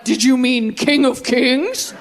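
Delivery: phone voice quoted. Retold or imitated. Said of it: "my iPhone's like".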